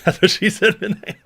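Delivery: reading through laughter; laughing. Real, not acted. Reading a joke chat comment.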